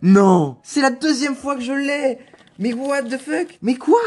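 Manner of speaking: very high, loud squeals of disbelief; overexcited screaming. Real, not acted. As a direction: scream and laugh, beside yourself with joy. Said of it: "je suis un peu excité".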